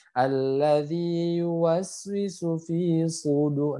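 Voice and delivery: slow chanted alladzi yuwaswisu fi; slow recitation. Shown as a demonstration. Teacher reciting the verse slowly. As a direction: recite with exact lengths. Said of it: "Dia harus tebal".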